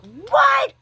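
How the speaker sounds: sudden high shout of disbelief; shocked shout. Real, not acted. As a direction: yell it out in shock. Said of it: "I flipped out".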